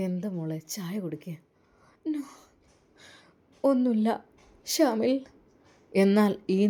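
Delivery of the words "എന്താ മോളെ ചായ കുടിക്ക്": hushed whisper, barely voiced. Whispering to his daughter over tea, hushed. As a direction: lean in and whisper it gently.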